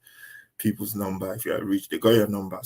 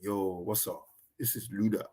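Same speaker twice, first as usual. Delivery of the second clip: [name]; 11 dB quieter than usual. Retold or imitated. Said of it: "[name] will be like".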